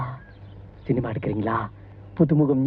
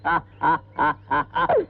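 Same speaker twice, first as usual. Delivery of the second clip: forced laugh on request; 9 semitones lower than usual. Pretend. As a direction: laugh on demand, in separate bursts. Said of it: "சென்டரா சிரிங்க". (கட்டாயச் சிரிப்பு)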